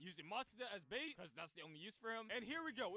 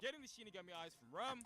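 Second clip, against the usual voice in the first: usual speaking voice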